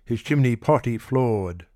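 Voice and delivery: plain reading voice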